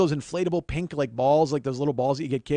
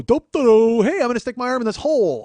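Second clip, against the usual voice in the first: loud, higher, hammed-up dimwit; dopey idiot; 7 semitones higher, 8 dB louder than usual. Mocking an idiot who jams his arm in any hole, loud and dopey. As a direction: play a clueless idiot blundering in. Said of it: "what kind of idiot would just stumble along and go".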